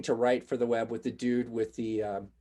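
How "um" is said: ordinary delivery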